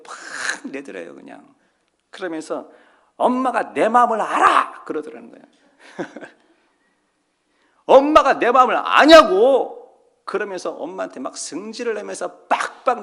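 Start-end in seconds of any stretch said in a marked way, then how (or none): 3.1-4.8 daughter snapping. Retold or imitated. 7.9-10.0 a daughter shouting slowly, much louder and higher; angry tearful shout. Retold or imitated. Daughter screaming, crying at mother.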